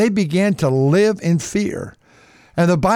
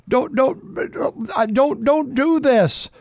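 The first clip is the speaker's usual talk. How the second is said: pleading Jacob; 7 semitones higher, much slower than usual. Acted. Jacob pleading over losing Benjamin.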